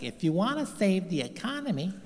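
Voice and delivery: a hint of an empty speech; imitating Kamala. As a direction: comedian mocking the vice president, lightly voiced. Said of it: "She's like".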